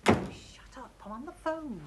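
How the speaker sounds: urgent half-whispered shushing; hushed telling-off. Acted. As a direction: shush someone while covering the phone. Hushing visitor while on phone.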